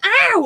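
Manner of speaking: short loud mock ow; mock yelp. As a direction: yelp as if just hurt. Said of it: "if somebody went".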